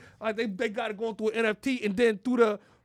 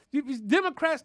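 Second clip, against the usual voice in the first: ordinary delivery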